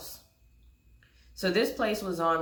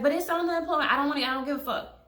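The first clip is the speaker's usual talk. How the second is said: much higher, louder mock protest; whiny friend protest; 7 semitones higher, 3 dB louder, faster than usual. The friend defending the ZipRecruiter job, high mock whine.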